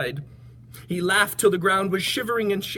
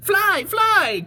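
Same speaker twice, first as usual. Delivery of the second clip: very high, loud bird call; shrill bird alarm call; 7 dB louder than usual. Acted. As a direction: call out a warning as birds. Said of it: "called the birds".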